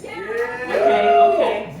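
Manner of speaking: very high, loud, squealing okay; excited squeal. Real, not acted. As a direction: squeal it back at the cheering crowd. Reacting to crowd cheering.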